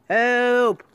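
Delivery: loud high shout; cry for help. Acted. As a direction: scream for help as he crashes. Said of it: "cried Danny".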